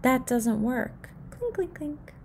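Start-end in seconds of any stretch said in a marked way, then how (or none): 1.3-2.1 soft clinking. A narrator's reading. Dimes falling sound.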